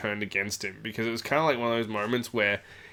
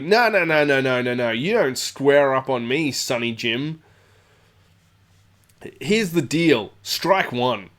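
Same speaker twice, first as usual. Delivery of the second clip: firm warning; 2 semitones higher, 4 dB louder than usual. Retold or imitated. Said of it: "Ian's like".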